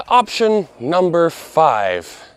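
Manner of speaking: big slow reveal of the last option; dramatic slow build. Shown as a demonstration. Host saving the best answer for last, slowly. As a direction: stretch every word, build suspense. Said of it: "And finally".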